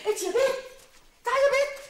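loud high call to the daughter. Acted. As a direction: shout for the girl from the next room. Mother calling daughter loudly.

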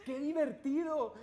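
loud, high delighted cry. Acted. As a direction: cheer like a kids host. Host delights after story.

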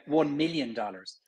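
"one million dollars" with light Irish L's. Shown as a demonstration. Actor showing the Irish L to the host. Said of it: "we would say".

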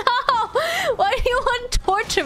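mock outrage. Real, not acted. Mock outrage at tongue twister.

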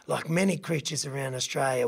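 usual speaking voice

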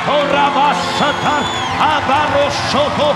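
high, loud fervent sermon cry; full-force preaching. Acted. Pastor urging the church through impossibilities, loud fervent preaching. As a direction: preach it hard to the congregation.

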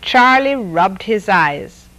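usual speaking voice

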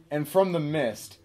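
ordinary delivery

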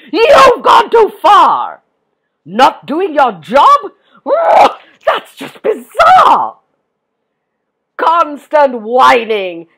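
angry yelling, slow and high; Tuffy. A narrator's reading. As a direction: bellow a scolding, drawing out the words. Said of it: "yelled Tuffy".

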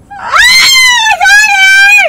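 pranking partner howling in fake pain; pained yell. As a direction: cry out as if the burn stings. Pretending burn pain.

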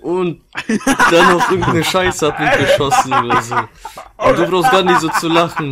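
high, loud excited retelling. Real, not acted. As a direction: rant half laughing.

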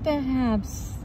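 plain reading voice

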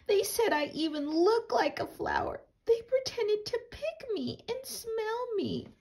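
put-on very high little girl's complaint. Chrysanthemum telling her parents about school, very high and hurt. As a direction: pitch it way up like a wounded kid.

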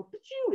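very high-pitched imitated snap; camera shutter noise. Turtle snaps the fallen rabbit's photo, high shutter noise. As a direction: voice a phone camera going off.

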